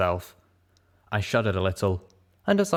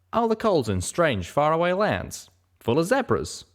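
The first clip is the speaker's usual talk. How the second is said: a higher, southern-tinged pegasus voice; a higher voice for Calamity, recalled offhand; 8 semitones higher than usual. Acted. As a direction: voice the cowboy pegasus lightly.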